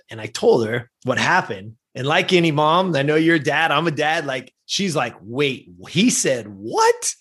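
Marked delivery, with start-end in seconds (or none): 5.2-7.1 slow disbelief, rising on the last word; imitated incredulous mom. Mom hears what coach told her son, drawn-out incredulous question. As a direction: draw it out and lift "what".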